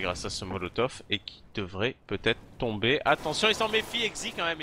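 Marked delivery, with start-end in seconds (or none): 3.1-4.6 excited call. Real, not acted. Caster calls a tense duel.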